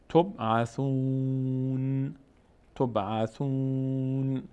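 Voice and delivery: تبعثون drawn out on a long held "oon", final n with no vowel; stopping on sukun. Shown as a demonstration. Teacher shows how to stop on the word, final sukun. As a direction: chant تبعثون slowly and close on a bare n.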